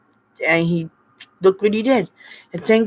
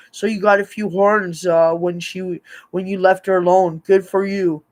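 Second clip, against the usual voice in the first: normal talking voice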